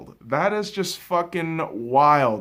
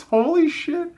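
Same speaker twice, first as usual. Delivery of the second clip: 1 surprised; 11 semitones higher than usual. Real, not acted. Disbelief at Cousins.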